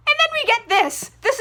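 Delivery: dramatic buildup. Real, not acted. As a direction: hype up the big reveal. Building up to the big line.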